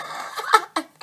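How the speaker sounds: short unvoiced laugh; breathy laugh at herself. Real, not acted. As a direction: laugh off the stumble. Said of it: "I can talk".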